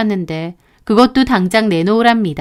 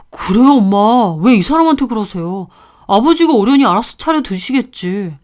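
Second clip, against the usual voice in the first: lightly voiced husband. Son defending wife to his mother, mild. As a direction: gently push back on mother.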